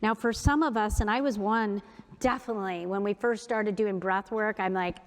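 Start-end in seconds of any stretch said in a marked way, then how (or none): none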